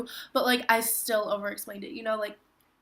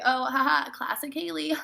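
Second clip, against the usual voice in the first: higher, brighter mock friend voice; amused friend; 4 semitones higher, 4 dB louder than usual. Friends used to Haley, teasing in a higher voice. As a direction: toss it off like an old friend used to her. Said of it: "they're like".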